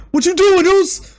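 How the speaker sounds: high, pressing plea to his brother; high pleading Jimmy. Retold or imitated. Host voices Jimmy calling Jay back, high and urgent. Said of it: "Jimmy's like".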